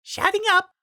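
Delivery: high, cowed goon voice; meek henchman voice. A narrator's reading. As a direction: back down meekly.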